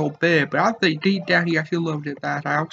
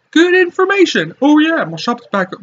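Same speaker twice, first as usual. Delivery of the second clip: high, loud cartoon shopkeeper; character voice; 10 semitones higher, 8 dB louder than usual. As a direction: bellow it like a jolly merchant.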